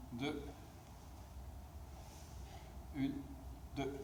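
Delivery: slow rhythmic rep count; workout rep counting. Real, not acted. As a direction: count the reps steadily. Coach counting hip extension reps.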